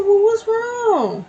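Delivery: very high, slow, worried coo; concerned baby talk. Retold or imitated. Worriedly cooing at the staring groundhog, high and slow. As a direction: fret over the little animal up high.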